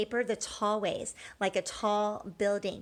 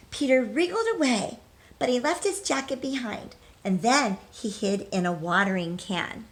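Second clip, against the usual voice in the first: bright, lively storybook read-aloud; reading to children. Acted. Teacher reading a picture book to children, lively.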